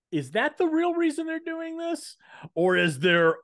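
mock suspicious.

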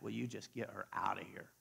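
low, soft grumble to Jesus; muted mock complaint; disciples' retold complaint. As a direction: grumble under your breath to get someone removed. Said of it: "the disciples are like".

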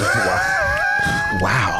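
very high astonished wow; shocked. Real, not acted. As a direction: squeal the wow in disbelief. Reaction to shout-out.

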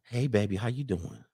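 low, soft, breathy-free sultry hello; sexy phone voice. Pretend. Tanya on the phone to a new man, low sexy voice. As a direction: purr it down into the phone like a flirt. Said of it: "on the phone sounding all sexy".

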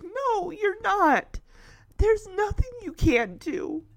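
pleading, choked-up reassurance; emotional insistence. Acted. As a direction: insist through rising tears. Chance tearfully reassuring Shadow.

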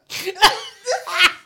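fast and high through laughter; words squeezed out laughing; laughing hard. Real, not acted. Laughing fit.

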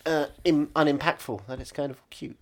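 low, dreamy voice; low character voice. Acted. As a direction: sigh it like smitten. Character voice again.

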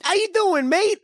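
high, shouted over-the-top Australian accent; Crocodile Dundee impression. Shown as a demonstration. Friend asked for an Australian, hammed-up high Australian shout. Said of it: "Like Crocodile Dundee?".

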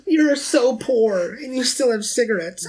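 high-pitched, laughing mock of a snob; mocking condescension. Podcaster imitating the woman looking down on a smoker, high mocking condescension. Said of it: "she's looking down on him".

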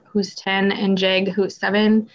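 normal talking voice

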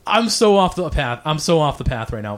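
ordinary talk, nothing marked

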